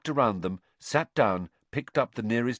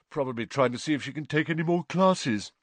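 higher-pitched words through a yawn; 7 semitones higher than usual. A narrator's reading. Boy remarking on his friend's keenness, yawning. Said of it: "yawned Ron".

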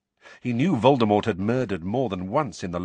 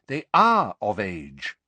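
weary; 5 dB louder, slower than usual. A narrator's reading. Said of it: "said Mister Weasley wearily".

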